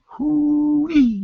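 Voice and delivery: high slow hoo-wee; whoop. Real, not acted. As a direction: marvel at a lucky escape. Exclaiming about surviving fire.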